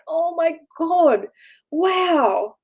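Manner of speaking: high, drawn-out adoring coo; acted swooning wonder; gushing at cuteness. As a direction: stretch it out slowly, melting with delight. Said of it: "it's just so cute".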